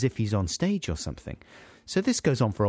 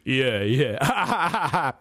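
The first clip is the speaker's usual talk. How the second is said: booming DJ; 4 semitones higher than usual. Retold or imitated. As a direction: boom it out like a DJ. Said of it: "in such a booming voice".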